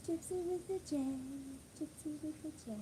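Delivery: sing-song pet voice. Real, not acted. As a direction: croon her name to the dog. Sing-song name to dog.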